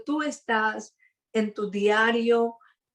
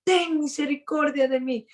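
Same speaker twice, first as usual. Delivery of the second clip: crying out; 5 semitones higher than usual. Retold or imitated. Bartimeo keeps crying out despite the disciples, raised plea. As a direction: call the plea out in a raised pitch. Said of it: "él gritaba mucho más fuerte".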